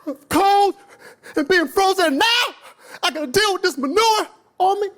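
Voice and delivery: high cartoon bird complaining about the manure; indignant bird. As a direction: play the bird, fed up and disgusted. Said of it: "the bird was like".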